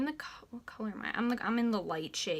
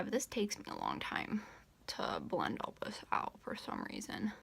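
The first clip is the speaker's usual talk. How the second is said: drops to a soft whisper, lower; whispered aside; 7 semitones lower, 7 dB quieter than usual. Real, not acted. Whispering to viewers while blending concealer. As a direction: murmur it under your breath.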